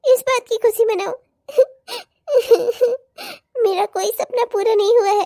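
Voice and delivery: tearful, anguished and bitter; crying. Acted. Bride-to-be telling fiancé her dreams are unfulfilled, crying. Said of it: "क्यों रो रही हो तुम?".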